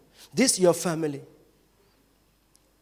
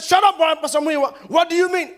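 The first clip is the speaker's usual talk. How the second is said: loud, high angry retort; a fellow pastor snapping back on the bus; angry shout; 9 semitones higher, 7 dB louder than usual. Retold or imitated. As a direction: bark it back at him.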